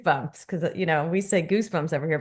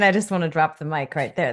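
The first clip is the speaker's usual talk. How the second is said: ordinary talk, nothing marked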